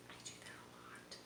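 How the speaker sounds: hushed whispered aside. Real, not acted. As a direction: drop to a secret whisper. Aside while reading book.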